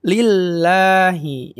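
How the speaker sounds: slowly chanted Lillahi, held double l; model recitation. Shown as a demonstration. Teacher repeats verse word, Lillahi slowly held. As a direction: linger on the l of Lillahi, long a. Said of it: "seperti itu ya".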